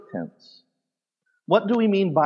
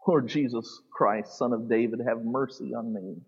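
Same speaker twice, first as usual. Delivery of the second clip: quiet plea; 4 semitones lower, 8 dB quieter than usual. Acted. The man's pleading cry to Jesus.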